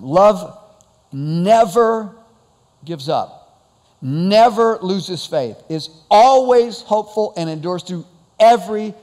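NEVER, ALWAYS and EVERY hit hard and loud; slow, loud preacher stress on each extreme word; emphasized extreme words. Shown as a demonstration. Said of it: "I'll emphasize them".

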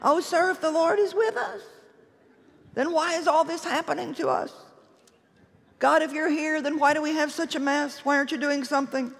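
a timid man pleading and grumbling at God; wimpy whiny Gideon. Retold or imitated. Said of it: "that really wimpy, whiny voice".

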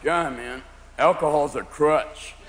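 low, slow, soft stoner; stoned friend. Acted. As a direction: drag it out like a mellow pothead. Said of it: "your friends who smoke marijuana going".

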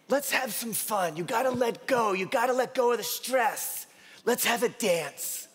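put-on high playful old-lady voice; Flavie's voice. Patient inviting her doctor to dance, playful high voice. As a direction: coax him into fun. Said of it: "And then she says".

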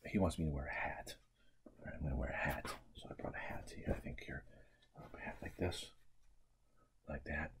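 soft, fast unvoiced whisper; whispered aside while putting on the hat. Real, not acted. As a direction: confide it to the listener.

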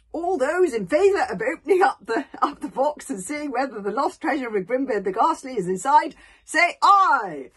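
loud booming call to vote; rally the whole hall; chief calls vote. Acted.

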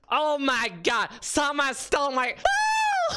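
high, loud shriek of dismay; outraged shouting. Real, not acted. Streamer finding someone stole the idea, high outraged shout. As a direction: build from a shout to a high scream.

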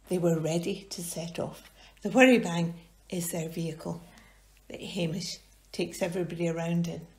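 ordinary delivery

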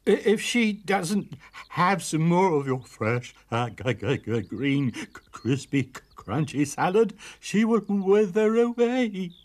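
high stuttering plea, climbing to a wail at the end; whimpering terror. A narrator's reading. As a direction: stammer on the consonants in fear. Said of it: "he whimpered".

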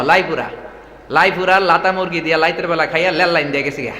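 every R becomes L, as in "লাতা মুরগি"; mimicked dialect, "লাইতের" with L at the start; R turned into L. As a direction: imitate locals saying "লাইপুরা" and "লেল line" with L for R. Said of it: "রায়পুরার লোকেরা রায়পুরা বলতে পারে না".

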